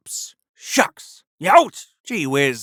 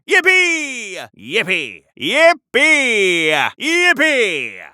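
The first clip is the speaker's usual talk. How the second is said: joyful cheering; 6 semitones higher, 5 dB louder, much slower than usual. Acted. Cheering joyfully during a ride.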